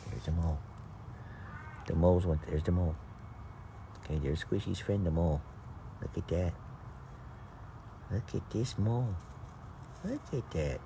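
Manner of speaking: quiet low murmur; hushed. Real, not acted. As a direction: talk softly so the mole stays. Hushed watching the mole.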